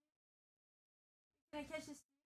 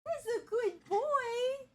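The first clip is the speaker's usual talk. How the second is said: much higher, slow, cooing praise for the dog; high pet talk; 12 semitones higher, 8 dB louder, much slower than usual. Real, not acted. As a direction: stretch it out sweetly to a good dog.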